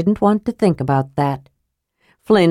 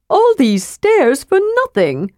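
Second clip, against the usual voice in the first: much higher, mock-tragic wail; kender dismay; 12 semitones higher than usual. Slumped kender to dwarf, much higher dismay. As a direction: lament the wasted climb. Narrator voices Tass.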